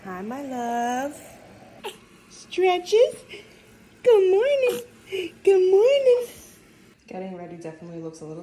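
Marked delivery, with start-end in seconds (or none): 0.0-1.2 tender. Real, not acted. Greeting her waking baby. 2.3-6.3 high, loud sing-song coo; mother cooing good morning. Real, not acted. Cooing to her waking baby.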